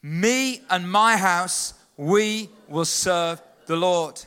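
slow, drawn-out congregational chant; slow unison declaration. Shown as a demonstration. Preacher leads the church in declaring the verse, slowly chanted. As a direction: declare it together, each word stretched. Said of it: "I want us to say that together".

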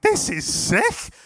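high, slow, drawn-out amazement; thrilled exclamation. Retold or imitated. Leader reacting to boys being healed, thrilled exclamation. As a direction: squeal it slowly in excited wonder.

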